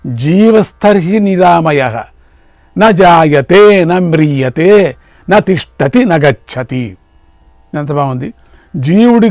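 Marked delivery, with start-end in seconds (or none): 0.1-7.0 slow, emphatic verse intoning with ringing long vowels; measured, forceful scriptural recitation; Sanskrit verse recitation. Acted. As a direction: draw out each phrase of the verse, pausing between.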